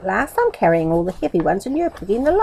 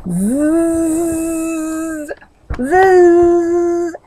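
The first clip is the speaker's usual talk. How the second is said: fly buzz; 9 semitones higher, 3 dB louder than usual. A narrator's reading. Fly buzzing around Meddle.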